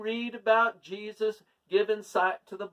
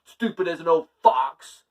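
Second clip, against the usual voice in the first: loud indignant retort, voice raised; mad and upset; 2 semitones lower, 9 dB louder than usual. Acted. King snapping back at Jack, loud and angry. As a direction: bristle and fire back like an offended king. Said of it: "the king just got all mad and upset".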